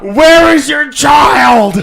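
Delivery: loud, slow, high shout. Acted. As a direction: yell it to break her. Acted interrogation after "Speak up.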